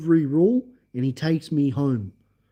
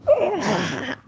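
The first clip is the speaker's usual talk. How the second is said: pained cry; 10 semitones higher than usual. Retold or imitated. Cry when thrown in crash.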